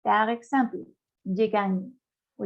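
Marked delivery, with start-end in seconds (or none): 1.2-1.9 "je gagne" with GN said as Spanish Ñ, ga-nye. Shown as a demonstration. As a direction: make the GN in "je gagne" one ny sound. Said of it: "da el sonido de la Ñ".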